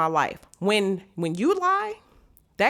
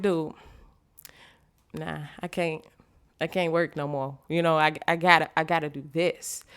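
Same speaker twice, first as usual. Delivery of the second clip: weary quitting; 5 semitones lower, 6 dB quieter than usual. Retold or imitated. Exhausted self-talk, quitting job.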